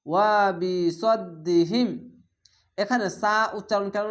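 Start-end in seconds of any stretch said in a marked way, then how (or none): 0.1-1.9 ওয়া বি সদ্দিহিম chanted slowly, doubled দ held; slow recitation. Shown as a demonstration. Teacher joining the whole word: slow chant.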